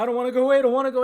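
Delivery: high, loud mock plea; imitated pleading cry. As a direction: plead like a frightened kid, acted. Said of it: "he starts crying".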